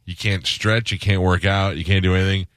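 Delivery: ordinary talk, nothing marked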